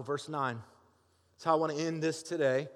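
ordinary talk, nothing marked

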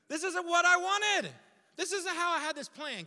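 high, loud protest; protesting to God. Retold or imitated. Preacher acting out his complaint to God, protesting. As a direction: cry out in frustrated dismay.

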